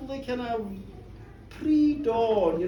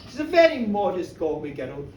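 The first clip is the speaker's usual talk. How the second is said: usual speaking voice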